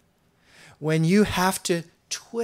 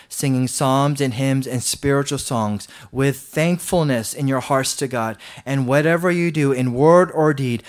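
louder, solemn reading of the verse; scripture reading; 6 dB louder than usual. Acted. Preacher reading Colossians aloud to the church, proclaiming.

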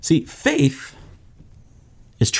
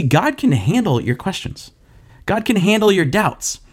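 ordinary delivery

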